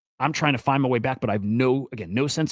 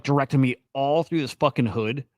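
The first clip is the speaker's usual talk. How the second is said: normal talking voice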